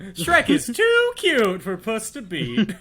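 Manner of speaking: high, slow, exaggerated delivery; hammed-up slow high voice; mock dramatic. Mock dramatic correction of friend.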